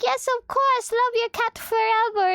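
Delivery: high, bright-eyed promise; high eager reply. Retold or imitated. Answering Jaws's thanks with a high, eager chirp. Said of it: "And I'm like".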